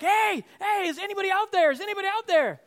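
high, loud retold shout; screaming for survivors. Avalanche survivor searching for buried people, screaming out. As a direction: call out urgently across the snow. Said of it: "I'm screaming".

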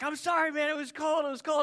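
high-pitched, protesting apology; pleading excuse. Retold or imitated. Friend excusing himself while yelled at, high pleading.

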